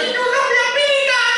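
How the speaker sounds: very high, loud retort; acted panicked yell. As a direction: act out shouting back at the kids mid-ride. Ayah membalas teriakan di wahana. (balasan diteriakkan dengan melengking)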